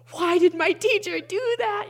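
high, loud mock disbelief; exasperated. Teen retelling his dismay at the teacher's email, loud mock exasperation. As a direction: throw up your hands at the teacher. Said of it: "And I was like".

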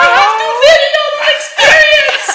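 very high, loud mock holler; imitated excited shout. Friend with phone in the waves, ecstatic shouting. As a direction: shout it out in a hammed-up sing-out.

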